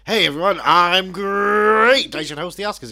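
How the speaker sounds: high, loud hammy showman; loud goofy host. Retold or imitated. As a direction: belt it like an over-eager host. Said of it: "And he's all like".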